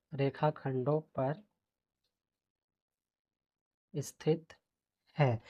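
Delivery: soft, word by word dictation; quiet slow dictation. Shown as a demonstration. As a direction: murmur each word as you write it. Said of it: "dictating answer while writing".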